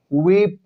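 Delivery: वीप with the ee stretched long; long ee in weep. Shown as a demonstration. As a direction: hold the ee in वीप. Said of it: "ई का उच्चारण को लंबा खींचा". (वीप में ई लंबी खींचें)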